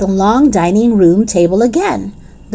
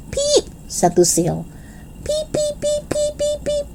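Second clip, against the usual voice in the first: very high, much softer squeaky peeps; fading peeps; 6 dB quieter than usual. Acted. Lucille peeps on in the dark, tiny and soft. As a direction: let the peeps trail away tiny.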